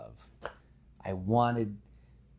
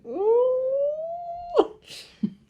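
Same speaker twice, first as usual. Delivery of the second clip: very high, loud mock wail; hammed-up squeal of holding back tears; mock crying squeal; 6 dB louder than usual. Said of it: "And Gwen".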